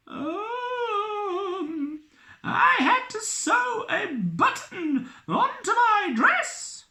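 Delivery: very high squeaky woman's voice; high-pitched voice. Acted. Elizabeth answering the captain, very high squeak. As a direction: squeak the reply. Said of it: "replied Elizabeth".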